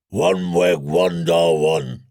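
very low, slow broken giant speech; rumbling giant voice. Acted. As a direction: rumble like a boulder crashing down. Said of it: "the Join's voice rumbled like a boulder".

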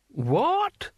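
very high, rising one-word squawk. Acted. Mad Hamish fails to catch the answer, high querulous query. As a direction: pitch the question right up.

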